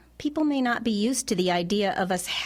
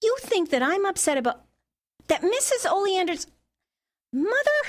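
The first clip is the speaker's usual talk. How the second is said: higher, halting indignation; affronted outrage; 7 semitones higher than usual. Acted. Daughter stung by her mother's suggestion, indignant. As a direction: break off in disbelief, then reproach.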